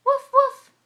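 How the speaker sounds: sharp, high, loud puppy woofs; two short punchy high woofs; puppy barks. Acted. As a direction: bark it out like a little dog. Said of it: "What does the doggy say?".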